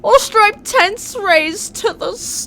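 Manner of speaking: loud, slow theatrical bard; drawn-out showman questions; grand rhythmic bard. Acted. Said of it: "I'll do the bard voice".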